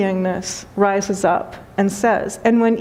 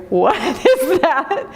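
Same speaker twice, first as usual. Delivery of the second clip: amused; 6 semitones higher, slower than usual. Retold or imitated. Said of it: "people would be like".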